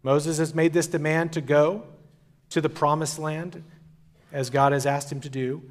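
ordinary talk

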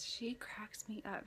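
slow breathy whisper, no voice. Real, not acted. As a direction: keep it to a hush. Baby asleep nearby.

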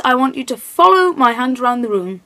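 ordinary delivery

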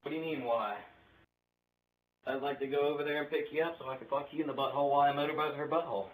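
ordinary talk

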